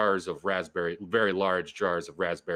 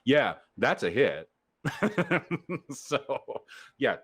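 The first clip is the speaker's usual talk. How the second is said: normal talking voice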